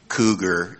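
low warning from inside the head; little inner voice. Retold or imitated. Inner voice warning a man out at night, low and ominous. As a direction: drop the pitch for an ominous one-word alert. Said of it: "a little voice in my head said".

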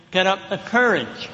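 ordinary talk, nothing marked

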